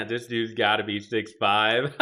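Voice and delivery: loud, slow, laughing delivery; amused. Real, not acted. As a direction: say it drawn out, breaking into laughter. Laughing at height guesses.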